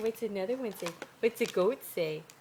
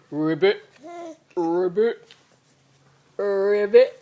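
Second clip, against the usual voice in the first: low, loud croaking ribbit; frog croak; 3 semitones lower, 8 dB louder than usual. Pretend. Grandma croaking low and loud for the baby.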